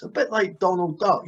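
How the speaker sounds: ordinary talk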